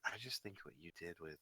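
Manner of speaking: hushed, shy-sounding fan talk; soft admiring Irina. Acted. Irina confiding admiration to the hero she looks up to, hushed. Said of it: "Irina's been talking to you".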